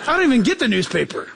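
normal talking voice

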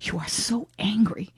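slow, breathy stage whisper; imitating a snide reality-star whisper; mock whisper. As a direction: hiss it condescendingly like a TV housewife. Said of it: "Lisa Rinna just looks at her and says".

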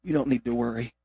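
put-on calm a scared child gently; reassuring father. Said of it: "He said".

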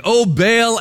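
high, loud invocation; calling on a god. Acted. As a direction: cry out to your god. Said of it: "called upon the name of Baal".

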